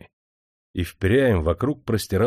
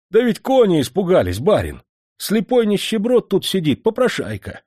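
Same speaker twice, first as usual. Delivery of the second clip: servant blurting it out, higher and louder, agitated; 8 semitones higher, 4 dB louder than usual. Acted. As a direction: rush the excuse to the master, worried. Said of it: "взволнованно заговорил слуга". (взволнованный слуга, голос выше и громче)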